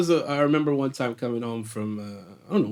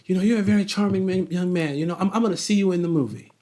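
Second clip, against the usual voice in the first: retold casting director; 7 semitones higher, 3 dB louder than usual. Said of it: "she goes".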